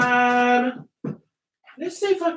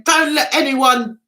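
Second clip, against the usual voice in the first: ordinary delivery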